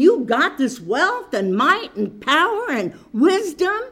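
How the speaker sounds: loud, rising preacher's cadence, building; fervent preaching. Acted. Preacher urging the church to read Deuteronomy, fervent preaching. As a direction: drive the scripture home to the congregation. Preaching, voicing God's word.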